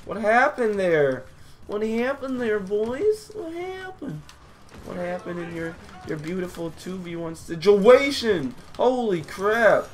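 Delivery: high-pitched, drawn-out crowing; slow, high victory taunt; gloating excitement. Real, not acted. Crowing after winning two v one.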